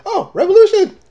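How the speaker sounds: high, loud mock cheer; Korg. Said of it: "he gets, he's like".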